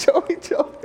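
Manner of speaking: high, breathy giggle through the name; mock nervous giggle as Mary stalls Joseph; nervous laughing. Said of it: "starts doing this nervous laugh".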